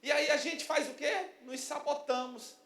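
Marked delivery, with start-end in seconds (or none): none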